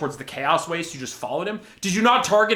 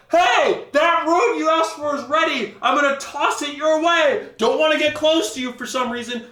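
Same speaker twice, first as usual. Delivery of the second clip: mock distant shout; 8 semitones higher than usual. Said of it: "shout at him from super far away like".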